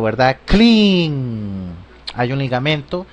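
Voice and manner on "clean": clean with the cl run together; clean, c and l blended smoothly; linked English clean. Shown as a demonstration. Teacher showing the linked sounds. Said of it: "quiere decir que hay un ligamento".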